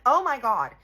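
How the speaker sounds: high, very loud outburst; retold scream. Storyteller reliving finding the mess in her bag, retold scream. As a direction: cry it out in horror. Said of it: "I scream".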